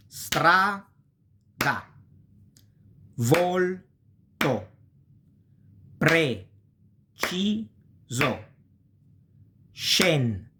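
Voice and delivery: "Strada", "Volto", "Preciso", "Scendere" said slowly, syllable by syllable; teacher spells the words out by syllable; syllable-split Italian. Shown as a demonstration. Teacher dividing Italian words into syllables for beginners, slow and careful. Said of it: "those words mean strada".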